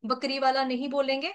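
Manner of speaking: ordinary talk, nothing marked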